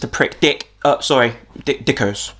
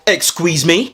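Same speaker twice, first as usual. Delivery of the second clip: ordinary talk, nothing marked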